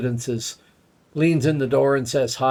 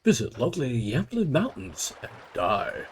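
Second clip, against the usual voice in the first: travel promo; 3 dB quieter than usual. Pretend. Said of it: "Sort of Midgard travel promos".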